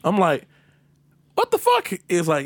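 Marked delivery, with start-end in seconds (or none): 1.4-1.9 very high mock-bewildered question; incredulous reaction. Driver told to stay by cop, high incredulous reaction. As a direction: squeak it in disbelief.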